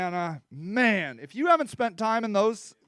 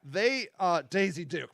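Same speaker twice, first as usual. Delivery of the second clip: ordinary talk, nothing marked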